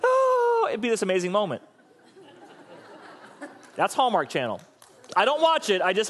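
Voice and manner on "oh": very high, loud "oh" squeal; hammed-up joyful squeal. Preacher caps the spoofed baby news, joyful squeal. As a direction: squeal in mock overjoyed rapture. Climax of spoofed Hallmark scene.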